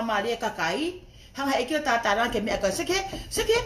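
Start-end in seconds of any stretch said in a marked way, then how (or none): 2.7-3.5 laughing. Real, not acted. Laughing disbelief before outburst.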